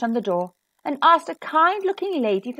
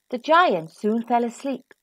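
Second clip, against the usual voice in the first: ordinary delivery, nothing marked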